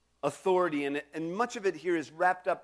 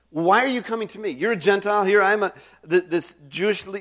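louder, higher pointed challenge to the Gentile; imitating Jesus' challenge; 3 semitones higher, 8 dB louder, faster than usual. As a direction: press back on the request, lightly voiced. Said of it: "In other words, sort of like".

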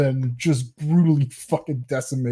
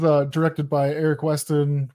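ordinary talk, nothing marked

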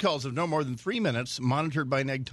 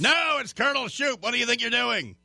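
play-acted indignant outburst, high and raised; annoyed colonel; 9 semitones higher, 3 dB louder than usual. Colonel scolds the child caller, mock annoyed bark. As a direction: explode at the caller like an affronted colonel, hammed-up. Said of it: "fully annoyed".

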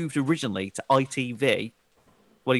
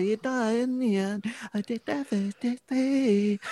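high, far-off put-on voice; sing-song mock performer voice; Lombarda impression; 10 semitones higher, 4 dB quieter, slower than usual. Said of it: "Is that your impression of the Lombarda?".

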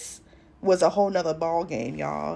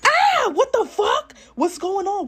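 shocked shout; 14 semitones higher, 10 dB louder than usual. Retold or imitated. Shocked at the strap-on.

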